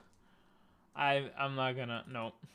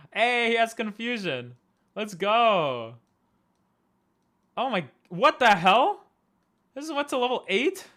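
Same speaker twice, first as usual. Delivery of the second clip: suddenly much higher and louder, thrilled; excited surprise; 7 semitones higher, 9 dB louder than usual. Real, not acted. Streamer thrilled her Alakazam got a move, excited surprised shout. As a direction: burst out with delight at the good move.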